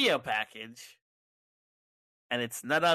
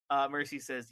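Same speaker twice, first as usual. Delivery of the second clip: ordinary delivery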